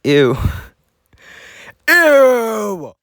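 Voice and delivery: high, loud shrieked ew; disgusted shriek. Real, not acted. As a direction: squeal in real disgust. Disgusted at own old image.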